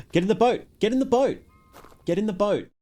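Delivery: high-pitched, urgent repeated call; high and insistent, pushing a friend toward the boat; excited urging. Real, not acted. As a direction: gasp then hurry him into the boat. Gasps, urging the other player.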